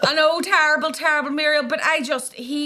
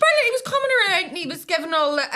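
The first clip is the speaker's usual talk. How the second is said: ordinary delivery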